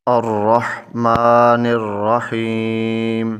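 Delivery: very slow recitation with long held vowels; Quran recitation. Shown as a demonstration. Teacher reciting the verse to students, very slow measured recitation. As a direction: stretch every syllable in measured tajweed. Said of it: "سورہ فاتحہ کی آیت number دو اور تین".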